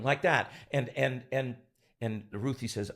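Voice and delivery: ordinary talk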